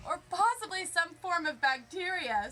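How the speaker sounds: lightly voiced eager expert. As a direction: push it up and out like an eager scientist. Expert voiced in read story.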